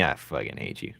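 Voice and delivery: flat hateful aside after a fake laugh; deadpan mutter.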